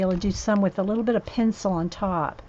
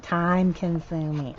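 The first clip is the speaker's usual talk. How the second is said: slow and drawn out; 2 semitones lower, slower than usual. Real, not acted. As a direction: drag it out, resigned. Repeats it drawn out.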